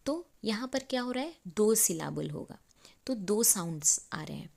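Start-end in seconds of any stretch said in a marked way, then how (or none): none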